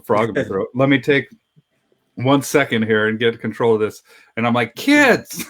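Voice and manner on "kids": sharp high yell. Retold or imitated. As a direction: call the kids in, loud. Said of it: "I'm like yelling at their kids".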